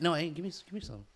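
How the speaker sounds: ordinary delivery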